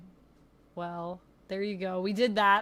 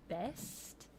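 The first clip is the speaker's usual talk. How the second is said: put-on trying Lucy's accent; 11 dB quieter than usual. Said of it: "trying to sound like Lucy".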